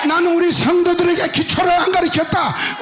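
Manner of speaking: loud, high repentant cry; tearful confession. Pretend. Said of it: "울고 갔으면 좋겠어".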